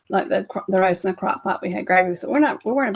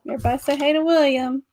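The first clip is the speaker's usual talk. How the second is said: sing-song greeting; 6 semitones higher, 4 dB louder than usual. Real, not acted. Greets baby on camera.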